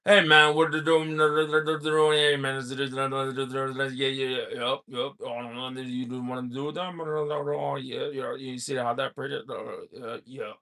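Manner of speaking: low, mumbled, fading rapid-fire mush; Boomhauer impression. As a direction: slur the words together until they're barely there. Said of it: "this is an impression of him".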